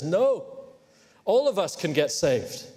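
ordinary talk, nothing marked

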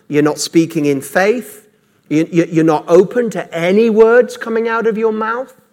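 ordinary talk

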